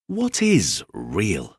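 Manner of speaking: slow, wondering little rabbit; small curious rabbit voice; rabbit asking. A narrator's reading. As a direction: ask it slowly like a shy toy. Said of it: "asked the rabbit".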